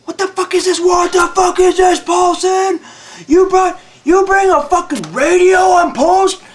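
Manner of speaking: high, loud furious yell; furious sergeant yelling. Retold or imitated. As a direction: bellow at the private like an angry drill sergeant. Sergeant yells at him.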